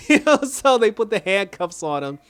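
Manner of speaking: high, laugh-filled retelling; real laughter. Real, not acted. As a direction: tell it while cracking up. Cracking up at the arrest.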